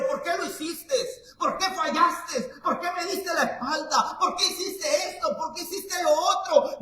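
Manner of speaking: reproche de juez severo, alto y agudo. Pretend. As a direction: imita a un juez que reprocha. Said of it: "No llega como un juez severo". (Dios imaginado como juez severo que reprocha)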